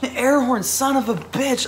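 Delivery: high, loud delighted outburst; thrilled yelp of delight; excited squeal. Real, not acted. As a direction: shriek with joy at the gift. Thrilled to get air horn.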